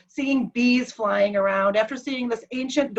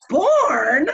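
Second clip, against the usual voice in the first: louder, high rising query; baffled echo; 5 semitones higher, 6 dB louder than usual. Retold or imitated. Confused husband asked his birth year, baffled echo. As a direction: swoop up incredulously on the word. Husband does not know the word.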